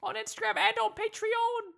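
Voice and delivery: high mock cockney urchin; terrible cockney accent. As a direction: sell the podcast like a cheeky London orphan. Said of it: "my terrible accent".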